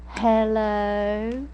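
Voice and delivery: drawn-out Southern phone greeting; slow Southern drawl; mother's Southern drawl. Retold or imitated. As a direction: imitate a Southern mom answering, stretching the word. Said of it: "hear my mother call us to the phone".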